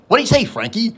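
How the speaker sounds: mild impression of the commercial lizard asking Frankie; Louie's voice. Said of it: "Louis would say something like".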